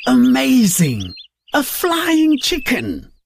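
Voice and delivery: high, slowed marvelling cry; amazed. Acted. Sparrows see the flying chicken, amazed. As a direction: gasp in wonder. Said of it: "The sparrows and the swallows said".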